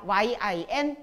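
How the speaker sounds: usual speaking voice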